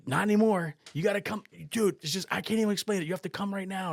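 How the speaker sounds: high, loud, insistent retelling; imitated urgent pleading. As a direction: press him to come at once. Friend's urgent phone plea retold.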